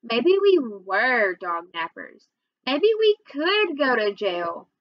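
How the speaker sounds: slow, stretched stress on "were" and "could"; drawn-out, weighted admission with "were" and "could" punched; dawning realization. A narrator's reading. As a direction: lean long on "were", then on "could". Narrator reluctantly realizes brother's point.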